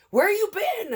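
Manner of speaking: high, loud playful call; hollered where-you-been, mock scolding; retold loud question. Crew guy greets her loudly.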